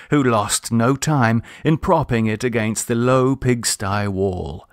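usual speaking voice

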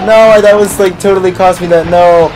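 high, loud rising panic as the timer runs out; panicking against the clock, voice climbing; frantic panic. Real, not acted. Gamer panicking at the countdown, high and loud.

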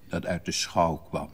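plain reading voice

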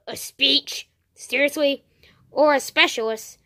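ordinary talk

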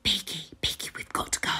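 urgent whisper. Acted. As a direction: hiss it so nobody hears. Sneaking the hippogriff away.

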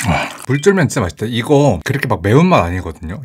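chewing while talking; talking with food in mouth; mouth full of noodles. Real, not acted. Seen 0: Slurped noodles just before; cheeks full, lips half-closed while talking.

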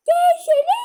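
high, loud yell; shouted call. Real, not acted. Shouting at her brother to come, high loud yell. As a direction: yell it across the house.

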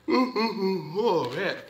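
oh man stretched out through a big yawn. Real, not acted. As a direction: yawn the words out, tired late at night. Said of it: "excuse me for yawning".

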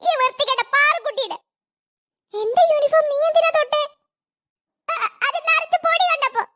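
high cartoon kid voice, defensive; a little girl caught out, stammering; squeaky kid voice; child voices. Children quarrel over uniform.

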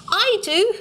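high, eager squeal; excited squeal. Acted. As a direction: burst out with glee. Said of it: "I squealed".

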